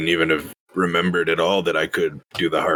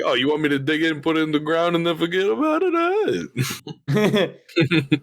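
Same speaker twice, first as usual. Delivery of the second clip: laughing; 10 semitones higher than usual. Retold or imitated. Voicing a magma, laughing.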